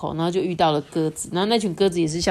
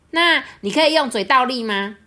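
higher, louder teasing question; teasing crow question; 6 semitones higher, 4 dB louder than usual. A narrator's reading. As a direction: dare the peacock. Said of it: "crow asks peacock". (挑衅地问)

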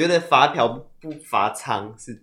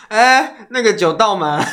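put-on drunk; 6 semitones higher, 4 dB louder, slower than usual. Shown as a demonstration. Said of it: "这样吗？".

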